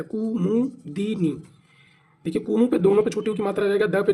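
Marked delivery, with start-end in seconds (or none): 0.0-1.4 कुमुदिनी drawn out syllable by syllable; said very slowly. Shown as a demonstration. Teacher showing the correct word to students, very slow. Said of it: "ऐसे लिखा था".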